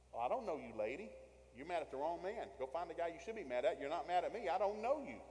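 loud, high, rising protest; indignant retort. Acted. Acted retort to angry protesters.

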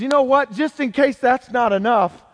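loud, high, fired-up setup; acted forceful escalation. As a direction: raise the voice as you up the threat.

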